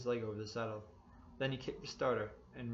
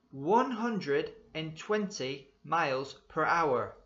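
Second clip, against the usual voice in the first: loud, slow, barking cop voice; barked; 6 semitones higher, 10 dB louder, much slower than usual. A narrator's reading. Policeman to speeding driver, a slow loud bark. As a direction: bellow each number out slowly. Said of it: "he barked".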